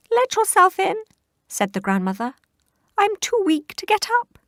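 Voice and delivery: put-on high, loud frail old voice; frail granny. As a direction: sound too weak to rise. Frail grandmother voice.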